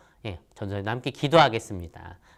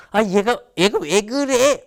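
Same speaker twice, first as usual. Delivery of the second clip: higher, slow, puzzled parent voice; surprised parent; 10 semitones higher, 6 dB louder, slower than usual. Retold or imitated. Parent reacting to a child's sudden hug, bemused and higher. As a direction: play a flustered mom, bemused.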